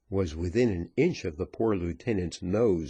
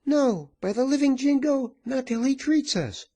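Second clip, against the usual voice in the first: high, loud outraged woman; high-pitched indignant outcry; crying out; 4 dB louder than usual. A narrator's reading. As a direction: cry it out in a high, forceful voice. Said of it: "cried one of the women".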